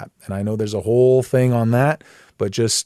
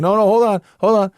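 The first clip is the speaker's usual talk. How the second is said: teasing Father voice; 7 semitones higher, faster than usual. Retold or imitated. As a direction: hold him off playfully, squeaking "not yet". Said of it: "Or is the Father going".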